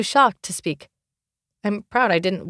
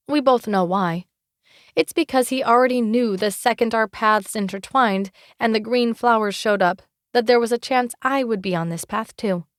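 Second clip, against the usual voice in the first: ordinary delivery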